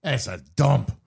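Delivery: put-on French accent. French businessman dismissing the Montreal club, mock French accent. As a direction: brush it off with a put-on French scoff. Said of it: "in a very thick, uh, French accent".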